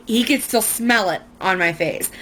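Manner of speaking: ordinary talk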